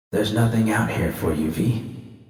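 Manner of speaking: soft, fading to a whisper, numb; numb murmur. Acted. V alone in the desert, whispering to herself numbly. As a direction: say it to yourself, barely there.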